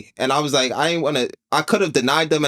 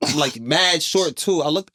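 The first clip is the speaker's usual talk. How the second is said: ordinary talk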